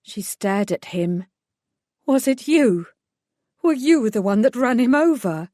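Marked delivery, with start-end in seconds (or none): 2.1-5.5 accusing. Acted. Mother accuses vicar of killing son.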